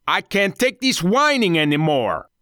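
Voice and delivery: high, angry outburst; exasperated shout. A narrator's reading. Ringleader fed up with the captive's whining, angry shout. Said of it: "the ringleader of the gang shouted".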